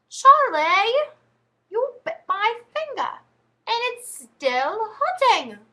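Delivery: put-on high, loud, slow English-accented wail; mock English accent. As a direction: imitate a hurt child in an English accent, high and stretched out. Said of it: "my mom does a really good impression".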